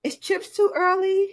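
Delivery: slow, high-pitched child imitation; pleading little kid. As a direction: mimic a kid angling for a snack. Imitates son begging for snacks.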